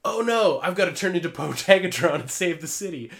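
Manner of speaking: loud, high heroic lament; dramatic anime hero. Pretend. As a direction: ham up the hero's dismay. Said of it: "then they're like".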